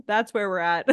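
ordinary delivery

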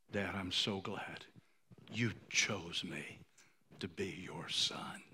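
moved, hushed reading. Real, not acted. Reading son's note, moved.

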